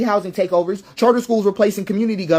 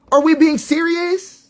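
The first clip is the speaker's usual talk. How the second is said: slower and higher, leaning on we; even more exasperated repeat; incredulous; 7 semitones higher, slower than usual. Real, not acted. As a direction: press the we in disbelief.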